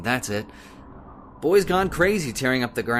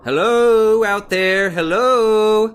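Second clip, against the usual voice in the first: loud, high call into the dark; 7 semitones higher, 8 dB louder, much slower than usual. Acted. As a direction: shout out to whoever is out there. Calling into the dark night.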